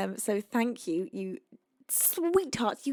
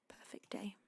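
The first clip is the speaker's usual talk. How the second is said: whisper; 3 semitones lower, 21 dB quieter than usual. Real, not acted. Whispering the song title.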